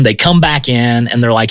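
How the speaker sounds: ordinary talk, nothing marked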